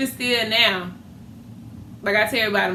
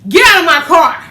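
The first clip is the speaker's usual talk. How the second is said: loud laughing shout of an angry wife; mock yell; 7 semitones higher, 11 dB louder than usual. Mother yelling at daughter to leave her car, loud and laughing. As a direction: bellow it, half laughing. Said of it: "Rita yell".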